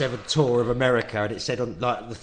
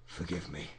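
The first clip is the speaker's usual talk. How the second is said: much softer, low, slurred apology; drunken Oliver Reed mumble; 8 semitones lower, 14 dB quieter than usual. Retold or imitated. Oliver Reed mumbling to the guests, low and slurred. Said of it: "And he goes".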